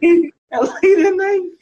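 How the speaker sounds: high, slow words through laughter; laughing. Real, not acted. Friend teasing the hyped guy, laughing hard. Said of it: "I ain't never heard nobody so happy".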